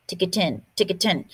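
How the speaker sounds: ordinary delivery, nothing marked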